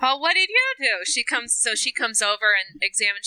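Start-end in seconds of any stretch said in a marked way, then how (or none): 0.0-1.0 high-pitched fussing nurse; concerned nurse. Acted. Nurse discovering a bleeding patient, high and fussing. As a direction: fuss over the patient in a higher voice.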